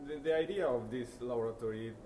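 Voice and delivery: ordinary delivery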